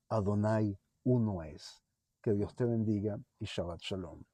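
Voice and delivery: quiet benediction; soft blessing. Acted. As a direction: bless them gently, quietly.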